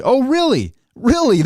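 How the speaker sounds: high, loud sarcastic "really?"; mock astonishment. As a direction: pretend to be amazed, mocking. Sarcastic reply to wage advice.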